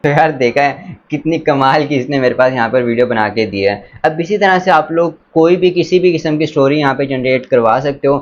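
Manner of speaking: ordinary talk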